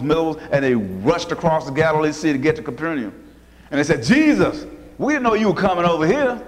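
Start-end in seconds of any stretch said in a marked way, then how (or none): 4.0-6.4 higher-pitched retold surprise; mock-surprised greeting, high on the name; surprised crowd. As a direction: imitate a crowd taken aback, pitched up. Crowd finding Jesus, surprised to see him.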